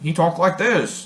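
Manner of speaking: amateur Irish accent imitation; attempted Irish accent. Shown as a demonstration. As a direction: have a slow, unsure go at Irish. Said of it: "who had an Irish accent".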